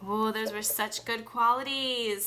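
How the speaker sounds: normal talking voice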